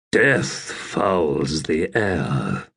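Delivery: a low, slow, lightly growled line from a wounded warrior; mild growl. Acted. Wounded Silchas Ruin facing the plain, a slow low growl. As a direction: drag the words out low and grim. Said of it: "Silchas Ruin growled".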